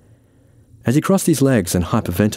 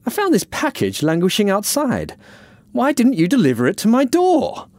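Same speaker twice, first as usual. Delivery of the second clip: much higher, demanding woman's voice; indignant Diane; 13 semitones higher than usual. Acted. Diane scolds Francis from her car, indignant. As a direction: demand an answer, high and affronted.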